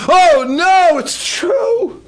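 loud, high wail of dismay; panicked cry. Acted. Midianite realizing the dream is true, acted high panic. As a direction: cry out in hammed-up terror.

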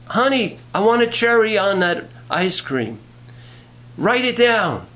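high calling to the kitchen; acted wife calling from another room; mock wifely call. Wife calls again to kitchen.

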